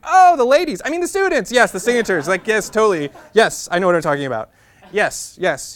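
high, loud flustered babble; flustered backpedal. Retold or imitated. Andy covering his slip to the student government caller, high, loud and flustered.

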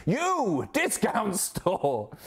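high, slow mock shop slogan; a silly jingle voice for a cheap shop; hammed-up ad slogan. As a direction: bellow it like a cheesy local advert.

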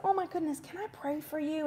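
softly reach out to a crying stranger; quiet, caring question; gentle offer. Retold or imitated. Gently offering prayer to crying lady.

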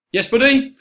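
high, loud call upstairs; yelling across the room. Real, not acted. Calling upstairs to crying son.

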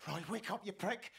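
frantic urging; desperate pleading. Acted. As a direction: shake him awake with your voice. Trying to wake dying friend.

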